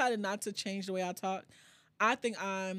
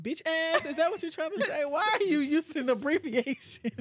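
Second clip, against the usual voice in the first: mock outrage; 7 semitones higher, 3 dB louder than usual. Mock-outraged retold question.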